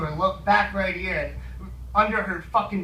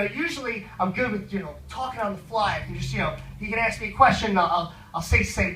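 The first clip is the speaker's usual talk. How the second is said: normal talking voice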